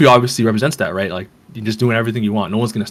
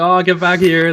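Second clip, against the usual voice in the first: high-pitched mock protest; imitated outcry, pitched up without shouting; mock calling back; 8 semitones higher than usual. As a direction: call after a runaway in a high voice. Acting out robbed villagers calling after her.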